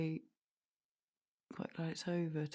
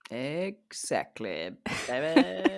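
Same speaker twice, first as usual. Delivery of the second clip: sultry drawl; slower than usual. Acted. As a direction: lay it on thick and slow. Drawn-out sultry reply.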